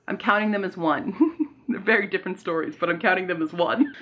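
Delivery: laughing while talking. Real, not acted. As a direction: chuckle through the words. Laughs at her own choice.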